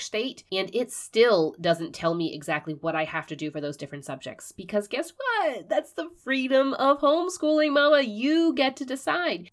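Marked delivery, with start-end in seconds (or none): 4.5-9.5 excited. Real, not acted. Repeating her excited punchline.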